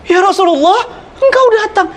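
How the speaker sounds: a hint of the grieving wife; wife's voice.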